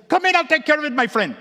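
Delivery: high, loud, eager shop owner; hearty repairman. Retold or imitated. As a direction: call the customer in loudly and eagerly. Voicing second repairman inviting him in.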